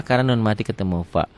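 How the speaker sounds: normal talking voice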